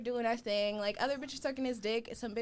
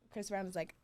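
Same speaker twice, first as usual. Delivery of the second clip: ordinary talk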